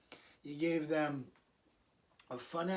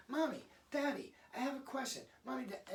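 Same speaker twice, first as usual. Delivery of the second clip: put-on higher child voice calling for a parent; imitating a child; 9 semitones higher, faster than usual. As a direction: lightly play the kid tugging at a busy parent.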